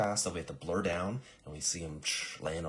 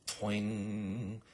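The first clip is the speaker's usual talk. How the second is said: ringing imitated "twing"; arrow twang; 4 semitones higher than usual. Arrow hits her clipboard, imitated drawn-out twang. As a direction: stretch out the quivering ring.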